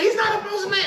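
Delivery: hammed-up outraged chief; mock angry insistence.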